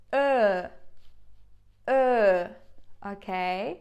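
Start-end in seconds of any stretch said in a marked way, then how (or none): 0.1-2.5 "Ö" with lips in a small circle pushed forward; Turkish ö demo. Shown as a demonstration. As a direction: say "Ö" like her with rounded lips. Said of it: "mouth is a little bit forward".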